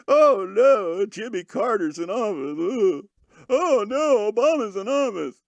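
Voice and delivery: high, wavering fake sobs; mock crybaby wailing. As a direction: bawl it theatrically, ridiculing the crybabies. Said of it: "You didn't see me crying".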